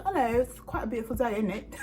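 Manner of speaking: chirpy small talk in fake British; fake British accent. Greeting a cab driver or shop stranger in fake British accent. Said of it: "I will do a fake British accent".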